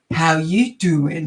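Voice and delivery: put-on American accent. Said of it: "try and do it in an American".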